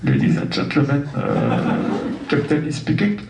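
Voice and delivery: calm airline captain on the intercom; pilot announcement. Pretend. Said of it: "l'annonce du commandant de bord".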